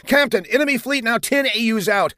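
high, urgent bridge report; erupting alarm. A narrator's reading. Bridge officer warning the captain, alarmed. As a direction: burst out with the warning. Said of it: "left side of the bridge erupted".

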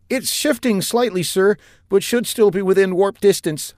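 high-voiced, jittery officer reporting; lieutenant reporting to captain, high and nervous; nervous young lieutenant. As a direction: report while still shaken.

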